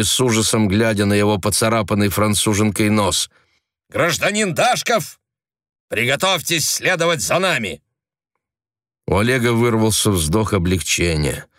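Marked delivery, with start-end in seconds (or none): 3.9-7.8 higher, official command; stern order. A narrator's reading. Agent arresting Oleg, stern formal order.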